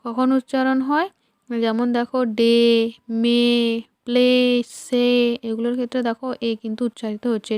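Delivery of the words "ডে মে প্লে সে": "ডে", "মে", "প্লে", "সে" said one by one with a long "ay"; "ডে, মে, প্লে, সে" as spaced teaching words ending in "ay". Shown as a demonstration. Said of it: "এরপরে এ হিসেবে".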